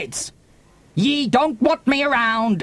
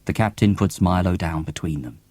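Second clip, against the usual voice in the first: ordinary delivery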